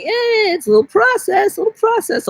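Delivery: high cartoonish brush-off; squeaky mock voice. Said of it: "we're like".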